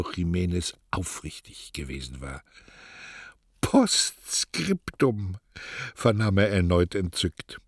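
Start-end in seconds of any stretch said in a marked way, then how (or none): 3.6-5.4 slow drawn-out word. Acted. Neruda's voice on tape again.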